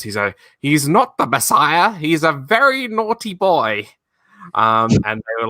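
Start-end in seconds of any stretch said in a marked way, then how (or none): 0.6-3.9 hammed-up high old woman scolding; mother's voice. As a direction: shriek it like an outraged mum. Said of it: "his mother says".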